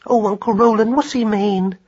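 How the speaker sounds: high-pitched anxious questioning; nervous. A narrator's reading. Worried traveller appealing to uncle for meaning, high and nervous. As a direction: plead for an explanation in a high, worried voice. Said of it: "conversed in nervous whispers".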